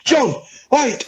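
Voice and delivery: high sing-song taunt; teasing shout. Retold or imitated. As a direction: call his name high to wind him up. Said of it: "we were just shouting at him".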